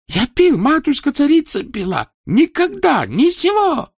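very high, loud pleading; frantic protest. Acted. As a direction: swear innocence frantically.

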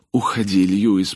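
loud urgent hiss. Acted. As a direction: push him away with it. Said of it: "прошипела она".

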